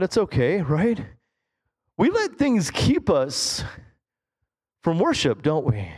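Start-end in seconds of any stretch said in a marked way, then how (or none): none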